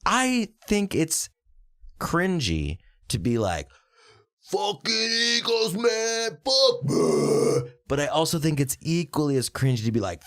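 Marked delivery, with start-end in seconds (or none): 4.4-7.7 high, strained put-on fan voice; drawn-out, high-pitched mock anguish; mock sports fan. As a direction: parody an overwrought die-hard fan. Mocks cringy sports fans.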